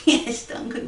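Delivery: plain reading voice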